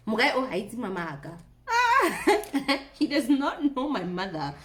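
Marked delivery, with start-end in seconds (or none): 1.7-2.9 high, loud laugh. Real, not acted. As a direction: laugh at the thought of mom. Laughing about her mother.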